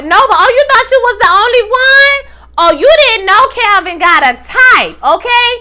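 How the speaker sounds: high, loud mocking taunt; sing-song sneer at her rival; mocking ex-wife. Ex-wife mocks Nova about Calvin's type, high and loud.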